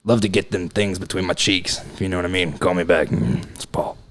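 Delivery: creepy Paul voice. Acted. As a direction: leave a creepy low message. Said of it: "It's me, Paul".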